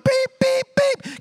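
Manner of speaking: high mock beeping of the car; high-pitched imitation car beeps; imitated key alarm beeps. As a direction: mimic the car's warning beeps, high. Imitating the key warning beep.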